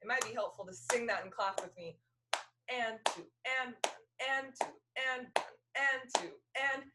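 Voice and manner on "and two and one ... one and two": "and" hit harder than the numbers; accented offbeat count. Shown as a demonstration. Fiddle teacher counting slowly for the class to clap along. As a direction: count it out, leaning on every "and". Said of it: "Let me do that slower".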